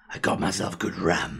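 low, slow, put-on macho voice; exaggerated deep man voice; deep manly voice. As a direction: draw it out low in a mock-manly voice. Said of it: "in a really deep, manly voice".

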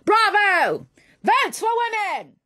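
high, loud cheering shout; loud rallying cry; crowd cheering. Acted.

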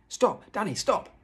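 high, loud urgent shout; shouted warning. A narrator's reading. Father stopping his son just in time, shouting. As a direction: shout to halt someone at once. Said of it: "he shouted".

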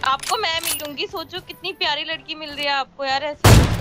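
playful coaxing. Pretend. Coaxing him with herself as prize.

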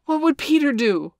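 high, loud urgent call; crying out together. A narrator's reading. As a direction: shout the question all at once. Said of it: "they cried simultaneously".

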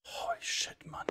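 breathless whispered shock. Real, not acted. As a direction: whisper it in disbelief.

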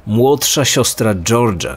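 usual speaking voice

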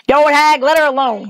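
a mild shout in someone's defence. A narrator's reading. Lear defends Dorothy from the witch, mildly shouting. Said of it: "he shouted".

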